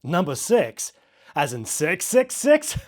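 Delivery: loud, high, amused question ending in a chuckle; chuckling question. Acted. DJ jokes with caller about the room number, chuckling question. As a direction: tease it upward, breaking into a chuckle. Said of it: "I asked, chuckling".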